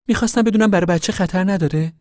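put-on a high, eager young pregnant girl asking her mother. Rose of Sharon worries about baby.

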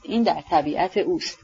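usual speaking voice

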